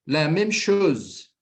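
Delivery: slow repeat. Shown as a demonstration. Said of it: "repeats phrase slowly".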